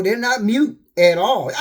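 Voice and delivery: ordinary delivery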